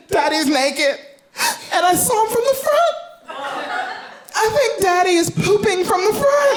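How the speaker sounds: put-on high, sing-song child voice; little girl voice. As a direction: tattle like an excited toddler. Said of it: "She said".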